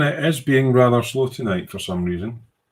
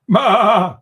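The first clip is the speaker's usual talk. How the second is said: laughing; 10 semitones higher, 5 dB louder than usual. Real, not acted. Laughing after reading a jibe.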